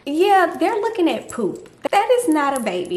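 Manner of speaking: OB-GYN correcting the mistake, incredulous; emphatic correction. Retold or imitated. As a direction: scoff at the other doctors. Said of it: "she was like".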